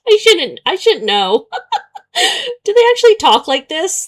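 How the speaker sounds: laughing, high and loud, in real disbelief. Real, not acted. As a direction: giggle through the question. Laughing at the game's dialogue.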